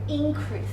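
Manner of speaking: Increase with a loud, high IN, then falling; stress on IN. Shown as a demonstration. Teacher demonstrating the strong sound, IN stressed in Increase. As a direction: punch the IN of Increase. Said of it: "the strong sound should be in".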